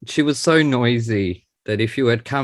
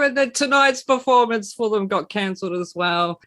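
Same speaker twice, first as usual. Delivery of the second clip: exasperated; 12 semitones higher, 3 dB louder than usual. Real, not acted. Shared outrage over cancellation.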